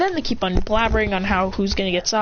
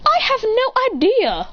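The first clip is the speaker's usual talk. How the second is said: high, slow singsong reply; exaggerated swooping "no idea"; clueless character; 11 semitones higher than usual. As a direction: draw it out high, utterly clueless. Character answers puzzled.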